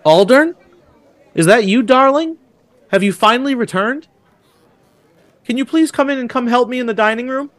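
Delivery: high, lilting woman's voice; female voice. Acted. Woman calls to Aldern from inside, high female voice. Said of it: "a female voice say".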